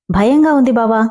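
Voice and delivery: usual speaking voice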